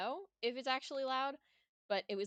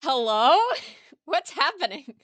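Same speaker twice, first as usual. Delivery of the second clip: startled surprise; 6 semitones higher, 13 dB louder than usual. Real, not acted. Startled by loud game sound.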